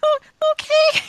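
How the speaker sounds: very high, timid squeak; frightened stammer. Acted. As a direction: stammer agreement in a high scared voice. Frightened receptionist obeys.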